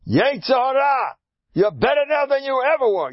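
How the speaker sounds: high-pitched, emphatic scolding; defiant rebuke. Acted. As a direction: shout down the inner voice. Scolding the evil inclination.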